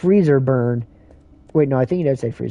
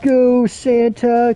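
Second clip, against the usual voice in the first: high, slow, drawn-out cheer for Santa; kids cheering; 9 semitones higher, 3 dB louder, much slower than usual. Retold or imitated. Kids in the cartoon cheering on Santa, high slow chant. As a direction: chant it like a crowd of kids rooting. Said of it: "'cause all the kids go".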